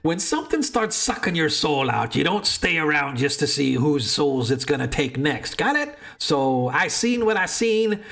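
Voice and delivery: high, agitated barfly voice; frightened drunk patron. Acted. DM NPC answers, pitch jumps.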